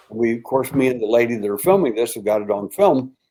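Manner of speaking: ordinary delivery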